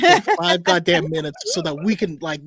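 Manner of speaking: amused rant; laughing. Real, not acted. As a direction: laugh while ranting.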